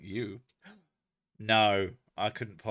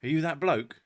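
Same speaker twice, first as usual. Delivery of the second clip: bloke's question; 4 semitones higher, 5 dB louder than usual. Retold or imitated. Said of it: "someone walks up to him and says".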